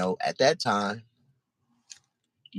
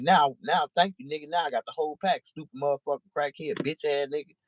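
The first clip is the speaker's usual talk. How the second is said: mocking gloat; 10 semitones higher than usual. Doobie gloating after shooting.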